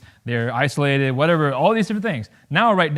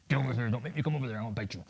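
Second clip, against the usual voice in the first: menacing dog; 5 semitones lower, 11 dB quieter, much faster than usual. Retold or imitated. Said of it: "They don't go around going".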